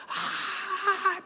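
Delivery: high, thin mock scream; imitated crowd scream. Neighbours shrieking in the building, mock scream. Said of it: "la gente gritaba".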